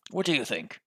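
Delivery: gruff British question at ordinary volume. Shown as a demonstration. Player retries the inspector's voice, restrained gruff British. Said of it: "Gruff, gruff British voice".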